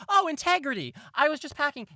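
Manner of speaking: high, sugary mom caught in the act; mom's sweet high voice. Acted. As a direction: caught red-handed, sing it out sweetly. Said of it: "and she'll go".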